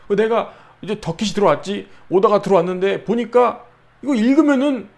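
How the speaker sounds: usual speaking voice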